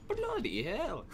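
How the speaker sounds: put-on high girly British accent; mock a British girl swearing. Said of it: "a British accent".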